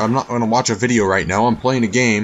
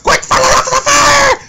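mock shout; 6 dB louder than usual. Scolding the crab on fire.

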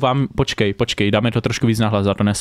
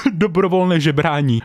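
laughing; 4 semitones higher than usual. Real, not acted. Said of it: "To chci, dobrovolné žebrání".

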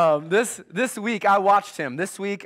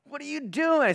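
exasperated dad; 8 semitones higher, slower than usual. Retold or imitated. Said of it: "So I'm like".

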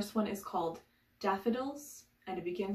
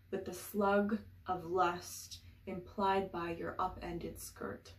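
fable-like verse with emphatic openings and spacious pauses; measured poem recital; poetry reading; slower than usual. Acted. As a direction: lift each refrain, then settle into the line. Reads a poem aloud.

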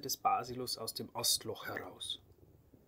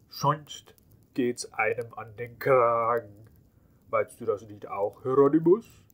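louder, mocking jab building to a loud call; gloating; 8 dB louder than usual. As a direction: needle him with spite. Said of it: "sah Hieronymus Kragenpeter hämisch an".

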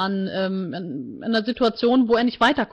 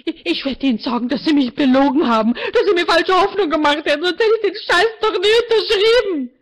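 much higher and louder, angry; furious tearful rant; 9 semitones higher, 4 dB louder than usual. A narrator's reading. Martina rages at the counselor, high and furious. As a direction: spit out every accusation.

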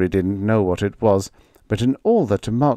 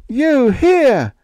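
high and loud, close to screaming; almost scream it; near scream; 7 dB louder than usual. A narrator's reading. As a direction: Higgins shrieking at the robot, high and loud. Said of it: "Higgins almost screamed".

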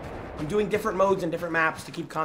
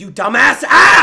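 loud, slow, higher angry yell; angry shout; 8 semitones higher, 16 dB louder, slower than usual. Real, not acted. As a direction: blast the app in rage. Raging at the app.

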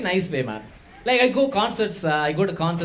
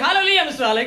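Tambram uncle voice; 9 semitones higher, 6 dB louder than usual. Retold or imitated. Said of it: "he's gonna say".

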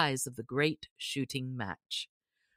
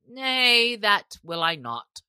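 a blunt, rough no, a bit louder; rough refusal; 6 semitones higher, 7 dB louder, slower than usual. A narrator's reading. Stranger rebuffing the sheriff's offer, rough and blunt. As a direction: refuse him flatly and proudly. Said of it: "quoth the stranger roughly".

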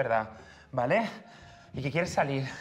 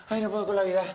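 higher, gasping complaint; breathless; 6 semitones higher than usual. Shown as a demonstration. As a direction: pant the words out after running. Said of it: "respiración entrecortada".

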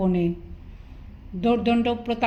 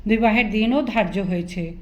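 ordinary delivery, nothing marked

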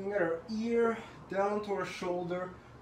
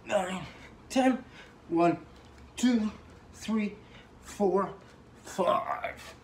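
louder, strained counting mid-set; doing push ups; 4 dB louder, slower than usual. Real, not acted. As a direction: push each number out with effort.

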